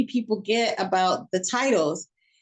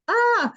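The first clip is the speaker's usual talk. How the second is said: exasperated ah; 13 semitones higher than usual. Retold or imitated. Overwhelmed by all the animals.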